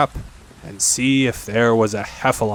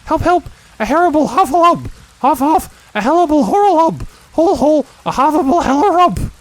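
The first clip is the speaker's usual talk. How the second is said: very high, loud squealing; tongue-tangled terrified yelling; Piglet's panicked crying out; 8 dB louder than usual. A narrator's reading. Piglet scampers off as hard as he can, panicked squeals. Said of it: "still crying out".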